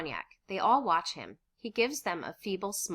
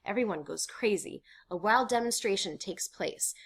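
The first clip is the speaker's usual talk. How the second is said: normal talking voice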